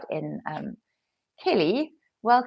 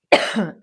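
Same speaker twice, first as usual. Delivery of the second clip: choking cough; 6 dB louder than usual. Real, not acted. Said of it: "hot drink went down the wrong way".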